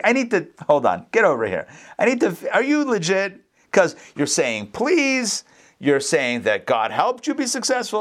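halting mock interrogation, jumping up in pitch; suspicious. Father checking whether the son is genuine, suspicious. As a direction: lightly act a father demanding proof. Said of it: "He's so suspicious".

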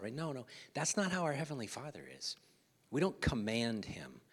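ordinary talk, nothing marked

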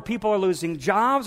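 ordinary delivery

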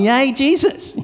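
high, loud "Yay, Jesus" cheer; excited cheer. Retold or imitated. Pastor cheering back to the neighbour, high and bright. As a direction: cheer it like a happy crowd. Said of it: "We're like going".

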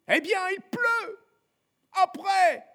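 high-pitched cheeky urchin shouting back; Gavroche. Acted. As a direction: call back with defiant unconcern.

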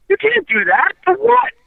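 high, incredulous objection rising on WHAT; imitating a baffled objection to a ruling; retold indignant protest. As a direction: protest in disbelief, pitch jumping up. Acted protest to the referee's ruling.

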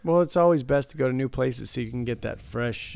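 usual speaking voice

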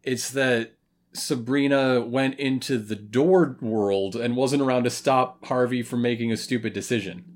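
ordinary delivery

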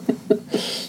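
quiet unvoiced laugh; soft airy chuckle; breathy laugh. Real, not acted. Laugh after quote.

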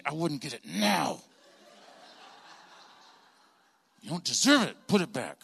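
seething, held-in parental anger, word by word; through gritted teeth. Retold or imitated. Angry dad scolds son at the checkout, through gritted teeth. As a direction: scold through clenched teeth so others won't hear. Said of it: "I gritted my teeth".

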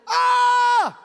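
long, high, very loud yell; old man's yell. Retold or imitated. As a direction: imitate a wordless screamed outburst. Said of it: "the guy starts yelling".

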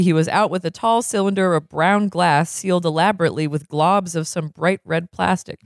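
ordinary delivery

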